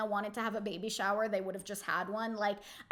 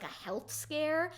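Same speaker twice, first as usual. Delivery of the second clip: ordinary talk, nothing marked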